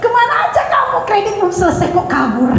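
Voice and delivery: high-pitched mock greeting turning to accusation; high, exclaiming, hammed-up challenge; mock confrontation. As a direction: call out an absconded debtor, playfully indignant. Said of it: "Lebih baik kita tanya".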